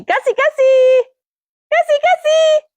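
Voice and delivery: very high sing-song call, held long; calling the bird. Real, not acted. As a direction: call the parrot in a high, drawn-out pet voice. Calling a parrot from afar.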